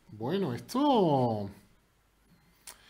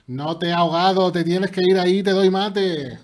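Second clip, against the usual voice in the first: loud, higher victory call; triumphant shout; 5 semitones higher, 14 dB louder than usual. Real, not acted. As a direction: announce checkmate gleefully. Da mate al rival. (grito de victoria)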